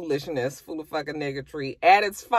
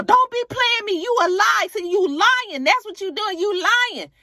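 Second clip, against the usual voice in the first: very high, loud accusing rant; yelling accusations; 4 dB louder than usual. Retold or imitated. Sierra yelling at BK as girls walk in, high loud rant. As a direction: scream he's lying. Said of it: "while she's yelling and screaming".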